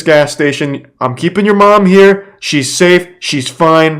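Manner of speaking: threatening scammer. Retold or imitated. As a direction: lean in on the threat like a phone extortionist. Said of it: "He's like".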